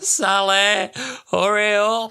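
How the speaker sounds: high frantic whine; Larry panicking. Retold or imitated. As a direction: squeal it out in a panic. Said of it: "he's just kinda like".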